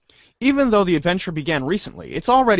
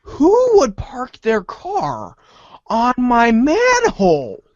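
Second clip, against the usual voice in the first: higher, slow aggrieved growl of a boss; indignant gangster; 7 semitones higher, 4 dB louder, much slower than usual. Acted. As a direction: play Spade Slick fuming. Voicing Spade Slick, indignant.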